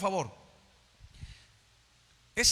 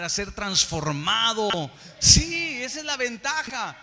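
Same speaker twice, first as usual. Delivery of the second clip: raised, forceful preaching with stretched key words; impassioned preaching; 4 semitones higher, 5 dB louder than usual. Real, not acted. Pastor telling the church God's word transforms, impassioned preaching. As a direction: build to an emphatic, exclaimed peak.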